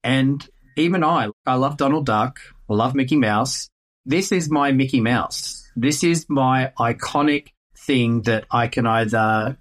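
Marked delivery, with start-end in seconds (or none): none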